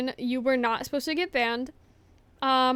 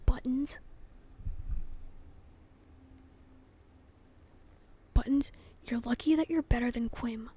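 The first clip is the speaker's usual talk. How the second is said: hushed mock threat; teasing whisper; 5 dB quieter than usual. Real, not acted. Streamer whispering a mock threat at the culprit. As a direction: whisper it like a playful warning.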